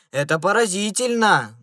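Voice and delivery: loud staged amazement; loud exclamation. A narrator's reading. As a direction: exclaim it for passers-by to hear. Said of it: "громко, чтобы было слышно прохожим".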